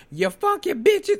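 high mock yell; mock yell from afar. Retelling Dolores cussing behind the wall, high mock yell. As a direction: holler it high as if through a wall. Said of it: "she's yelling from a whole different room".

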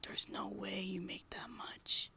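breathy whispered line; whispering. Acted. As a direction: whisper it in disbelief.

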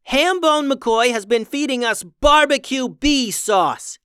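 high, loud public announcement; shouted accusation. A narrator's reading. Girl accusing Hambone in a full restaurant, shouted. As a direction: call it out loudly for everyone to hear. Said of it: "Samaya shouted".

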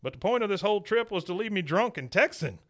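high aggrieved complaint; mock-hurt son; Hank whining. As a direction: protest the betrayal. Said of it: "Hank says".

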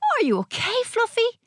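put-on high, gentle child's question; tender concern. A narrator's reading. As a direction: coo it to the cat. Child checks on hurt cat.

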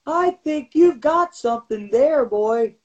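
put-on high, wavering old funeral-director voice; Paul Bearer. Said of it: "Paul Bear looked at me and goes".

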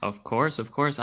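ordinary delivery

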